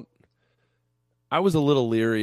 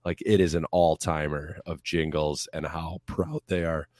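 usual speaking voice

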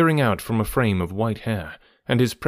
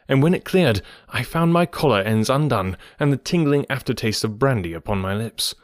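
plain reading voice

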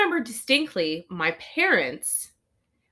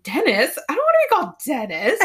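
mock indignant kid, higher pitched; Bluey; 5 semitones higher, faster than usual. Kid objecting to Dad's new name for her, mock indignant. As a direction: protest in a rush, like an offended child. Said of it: "Bluey's like".

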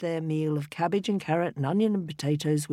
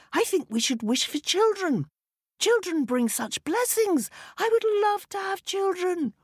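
higher, eager wife voice. A narrator's reading. Wife tells husband her wish, high and eager. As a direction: yearn for children.

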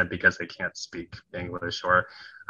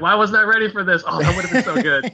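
high, loud laughing groan at himself; laughing self-mockery; 11 semitones higher, 7 dB louder than usual. Kicking himself hours later over a lost comeback, laughing loudly. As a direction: laugh through the regret. Regretting a missed witty comeback.

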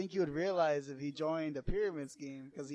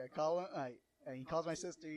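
ordinary delivery

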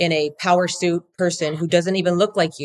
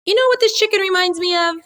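high, playful imitation of a southern drawl; 13 semitones higher, 3 dB louder than usual. Wife imitating husband's southern drawl at the chickens, hammed-up. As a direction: ham up a folksy southern drawl. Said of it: "In his southern drawl".

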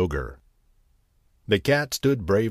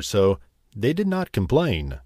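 usual speaking voice

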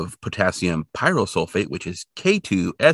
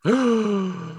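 high startled gasp; mock gasp; 11 semitones higher than usual. Reacting to hieroglyph match.

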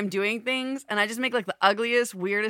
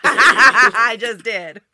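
loud, slow, laughing reply; laughing retort; 3 semitones higher, 12 dB louder, much slower than usual. Real, not acted. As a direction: laugh it out as a tease. Teasing after asking the question.

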